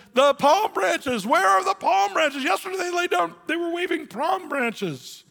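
hurt donkey pleading for palm branches; whining donkey. Acted. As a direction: wail for the lost praise.

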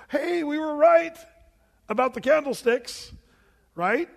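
gasp, then high loud delight; excited. Acted. Pastor thrilled the verse confirms the congregation's guess. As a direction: burst out with joy at the discovery.

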